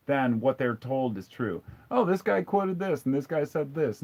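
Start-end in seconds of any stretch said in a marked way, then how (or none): 1.9-4.0 higher-pitched mock credulous voice; mocking imitation. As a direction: parody someone eagerly citing hearsay. Mimics credulous believers' reasoning.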